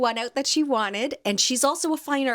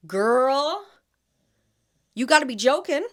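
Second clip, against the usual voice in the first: incredulous retort; 5 semitones higher, 5 dB louder than usual. Retold or imitated. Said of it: "I looked at her like".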